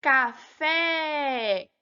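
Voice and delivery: "Café" with FÉ held long; stress on final FÉ. Shown as a demonstration. Teacher showing pupils the tonic syllable, stress on FÉ in "Café". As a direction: draw out the last syllable FÉ in "Café". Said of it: "A sílaba tônica".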